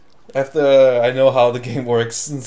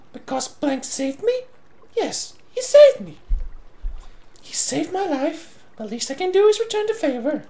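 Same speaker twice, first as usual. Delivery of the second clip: put-on a young hero's voice, pitched up; higher character voice; 11 semitones higher, 6 dB quieter than usual. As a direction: play it higher and earnest.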